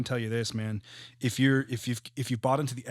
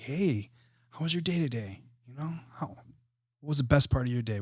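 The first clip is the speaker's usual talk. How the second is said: gentle and kind; 3 semitones higher than usual. Retold or imitated. Father asking his young children about their day, gently. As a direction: ask lightly, like tucking kids in. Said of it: "really gentle and kind curiosity".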